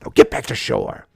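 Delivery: low, harsh growled order; stern dad. Pretend. Brody ordering his son back, low and harsh. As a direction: bark it like a furious father. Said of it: "wanted Brody to slap him ... and say".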